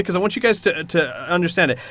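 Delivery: ordinary delivery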